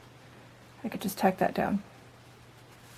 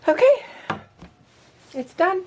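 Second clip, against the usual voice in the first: pleased; 10 semitones higher, 4 dB louder than usual. Real, not acted. Finishing the sewing.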